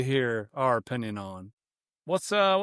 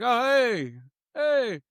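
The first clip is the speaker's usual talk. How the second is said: surprised hey; 8 semitones higher, 5 dB louder, much slower than usual. Retold or imitated. Retold surprised greeting.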